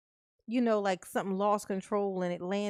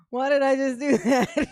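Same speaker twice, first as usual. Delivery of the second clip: getting louder and breaking into laughter by the end; laughing at herself; 5 semitones higher, 9 dB louder than usual. Retold or imitated. Telling her son what she just did, laughing at herself. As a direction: laugh through the self-scolding. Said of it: "And I was like".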